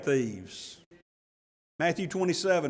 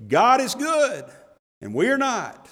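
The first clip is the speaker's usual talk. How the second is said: louder, "good" punched and falling; emphatic summary; 4 semitones higher, 3 dB louder than usual. Shown as a demonstration. Preacher summing up salvation for the congregation, emphatic. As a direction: hammer home "good" and "not". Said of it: "sum up eternal salvation".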